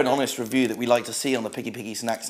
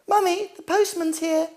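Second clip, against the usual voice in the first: put-on high piping little kid calling out; child's voice. Son calling to mummy about the postman, high childish voice. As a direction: chirp it like an innocent small child. Joke about his real father.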